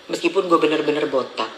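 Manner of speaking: usual speaking voice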